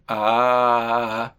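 sustained low "ah" to feel the vibration; voiced ah for the throat. Shown as a demonstration. (un "ah" sostenido y grave)

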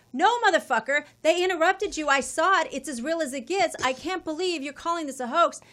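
loud, high, outraged comeback; angry rant. Retold or imitated. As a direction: yell it back at the screen. Ranting back at the president.

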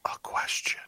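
soft, voiceless ghostly whisper; imitated faint EVP whisper; little ghost voice. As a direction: whisper it like a tiny spirit on tape. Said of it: "you hear this little voice go".

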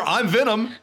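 high, mocking villain impression; hammed-up comic-book villain; Venom. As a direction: mimic a movie villain taunting the hero. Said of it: "It wasn't Topher Grace constantly revealing his".

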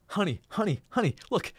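calling to his wife, giddy with joy; thrilled calling out. Retold or imitated.

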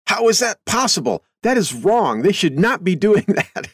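lightly voiced protesting teenager; imitating teenage son. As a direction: mimic the outraged son. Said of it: "and he'll say".

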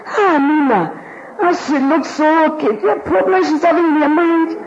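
mock gossip, pitched high; gossip shouting. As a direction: broadcast her troubles like a gossip, high. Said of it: "take a megaphone and shout on the rooftop".

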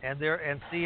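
usual speaking voice

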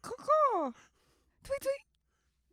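high squeaky bird calls; chirping bird person. Pretend. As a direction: caw and tweet like a bird.

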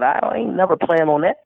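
usual speaking voice

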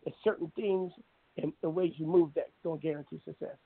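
ordinary talk, nothing marked

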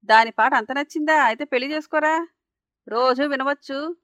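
sarcastic aunt, slowing on the final jab; sarcastic taunt. A narrator's reading. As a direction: taunt him with biting sarcasm. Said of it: "అని వ్యంగ్యంగా అంది దుర్గమ్మ".

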